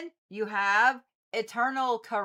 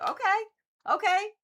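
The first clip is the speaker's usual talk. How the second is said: okay, sharply higher in disbelief; high startled okay; 12 semitones higher, 3 dB louder than usual. Real, not acted. To the cards that flipped sideways, startled and high. Cards fall against her wishes.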